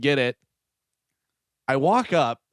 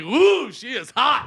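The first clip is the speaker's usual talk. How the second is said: mock shocked; 11 semitones higher, slower than usual. Reacting to the clerk's swearing.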